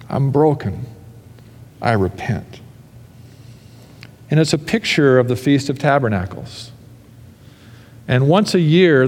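ordinary delivery

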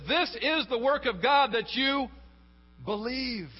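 high, loud, slow proclamation; God's emphatic declaration. Acted. Preacher voicing God answering those who keep trying, emphatic proclamation. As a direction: proclaim it forcefully, letting the last word stand alone. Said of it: "And God says".

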